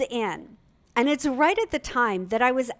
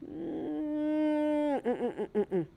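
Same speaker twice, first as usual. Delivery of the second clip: disapproving hum; 6 semitones higher, 5 dB quieter, slower than usual. Retold or imitated. Mother hums disapproval.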